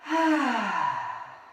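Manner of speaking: long loud releasing sigh. Shown as a demonstration. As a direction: let out a big, audible sigh. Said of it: "I'm gonna exhale with a loud sigh".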